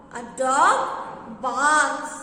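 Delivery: A dog barks said slowly; slow dictation to the class; slow teaching repeat. Shown as a demonstration. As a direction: spell it out slowly for children. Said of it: "What does a dog do?".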